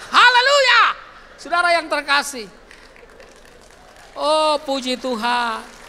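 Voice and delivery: loud, high shout of praise; joyful preacher's outburst; ecstatic hallelujah. Real, not acted. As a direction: cry it out to the congregation.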